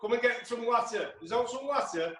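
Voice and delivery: imitating his grandma's thick Yorkshire accent, mildly. [name] mimics his grandma's Yorkshire accent for the host, lightly. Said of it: "She says stuff like".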